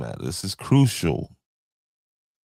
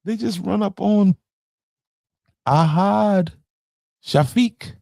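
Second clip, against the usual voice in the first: much higher, incredulous mock voice; mocking viewers' voice; 4 dB louder than usual. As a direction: imitate the doubting viewers, pitched way up. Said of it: "I know y'all be like".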